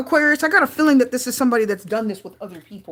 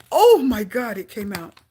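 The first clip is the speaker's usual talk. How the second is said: usual speaking voice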